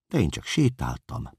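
ordinary delivery, nothing marked

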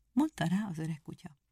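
plain reading voice

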